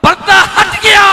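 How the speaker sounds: high, loud proclamation; climactic shout. Acted. Preacher announcing that the curtain was lifted, shouting. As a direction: cry it out at the climax.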